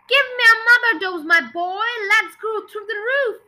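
loud, high, eager demand; screechy old voice. A narrator's reading. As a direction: call down like a screechy old granny. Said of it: "old screechy voice from up above".

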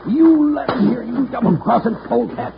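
high-pitched angry accusation; furious threat. Acted. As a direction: rage at a double-crossing partner. Betrayed villain threatens his partner.

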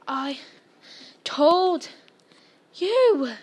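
one word at a time between gasps; high, loud words with long pauses; gasped. A narrator's reading. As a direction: gasp it out, winded from running. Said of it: "Hermione gasped".

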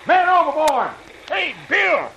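high-pitched alarm call; urgent shout. Acted. Sailor crying man overboard after his mate, urgent shout. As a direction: holler after him across the water.

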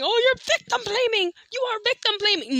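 high mocking voice of online critics. Vlogger mocks commenters in a high nagging voice. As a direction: sneer it back in a high nagging voice. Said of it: "people saying".

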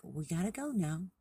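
gently acted storybook mom calling time; lightly voiced mother deer. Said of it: "the deer's mom's like".

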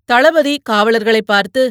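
ordinary delivery, nothing marked

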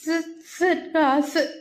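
can't get the lips apart, stammer. A narrator's reading. Scared boy trying to say his name, stammering. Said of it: "உதடுகளை அவனால் பிரிக்க முடியவில்லை".

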